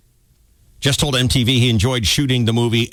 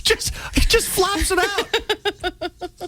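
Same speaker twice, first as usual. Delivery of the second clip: laughing; 4 dB quieter, much slower than usual. Real, not acted. Laughing through the story.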